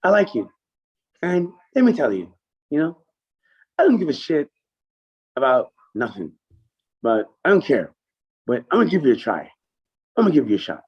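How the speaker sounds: high and loud gruff promise; imitating Kenny. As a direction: lift the voice as the blunt boss. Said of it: "That's exactly like Kenny".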